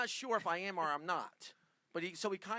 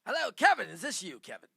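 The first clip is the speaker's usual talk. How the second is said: brash, booming fast-talking agent; loud showbiz agent; 8 semitones higher, 12 dB louder than usual. Pretend. Prank caller booming at Kevin as a blustering agent. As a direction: bark it like a pushy Hollywood agent. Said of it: "Do it, do it as Brian".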